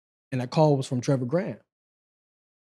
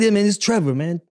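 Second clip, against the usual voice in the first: Trevor on the phone; 6 semitones higher, 4 dB louder than usual. Retold or imitated. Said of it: "He's like".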